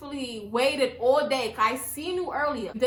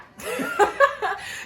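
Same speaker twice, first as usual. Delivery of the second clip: airy laugh, no voice; breathy laugh; 9 semitones higher, 4 dB louder than usual. Real, not acted. Laughing at the story.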